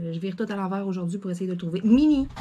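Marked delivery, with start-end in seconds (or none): 1.8-2.3 loud, high call to the cat; scolding call. Real, not acted. Calling the cat off things. (réprimande lancée au chat)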